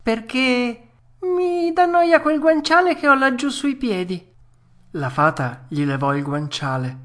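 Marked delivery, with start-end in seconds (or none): 0.0-4.3 high, loud fussy puppet; whiny excuse. Acted. Puppet blaming the pillow, high whine. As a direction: complain like a spoilt child, voice way up. Pinocchio invents excuses not to drink.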